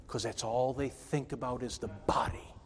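unvoiced, hushed whisper; whispered aside. Real, not acted. Preacher warning his congregation, hushed whisper. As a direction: drop to a whisper to drive it home.

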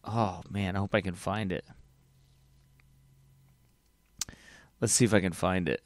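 low, very soft mumble; muttering to oneself. Real, not acted. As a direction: think aloud under your breath while searching. Muttering to himself while searching.

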